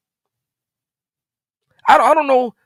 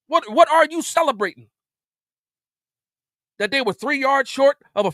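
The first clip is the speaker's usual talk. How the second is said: exasperated rant; 8 semitones higher than usual. Real, not acted. Ranting at celebrating player.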